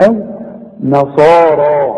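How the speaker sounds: نصارى drawn out slowly with a full, heavy ص; نصارى recited slowly with an emphatic ص; slow recitation. Shown as a demonstration. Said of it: "هنقول".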